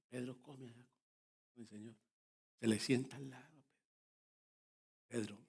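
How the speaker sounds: tender near-hush sermon story; hushed tender retelling. Acted. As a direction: drop the voice and draw the listeners in close. Hushed retelling of Jesus and Peter.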